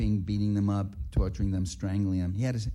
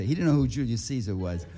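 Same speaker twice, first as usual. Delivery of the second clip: normal talking voice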